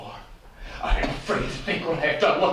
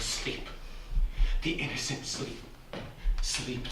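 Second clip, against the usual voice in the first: whispered; 4 semitones lower, 8 dB quieter than usual. Acted. Macbeth haunted after the murder.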